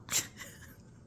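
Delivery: petit rire soufflé, spontané; rire amusé. Real, not acted. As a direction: pouffer de rire à ses propres souvenirs. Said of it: "Je ris parce que ça me fait rire". (rire spontané et amusé)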